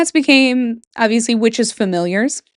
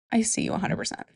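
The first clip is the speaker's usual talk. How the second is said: whisper; 11 dB quieter, faster than usual. Real, not acted. Cat owner solidarity aside.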